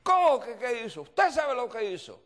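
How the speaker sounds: high, loud, incredulous retort; angry yelling. Retold or imitated. Woman answering the pastor's question, acted angry yelling. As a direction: fire it back as the enraged woman. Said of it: "Y comienza a gritarme".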